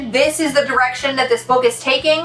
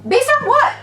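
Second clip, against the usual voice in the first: much higher, sharp disbelieving question; incredulous; 12 semitones higher than usual. Real, not acted. Reviewer baffled by the book's logic, incredulous. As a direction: squeak it out in disbelief. Outraged at the book.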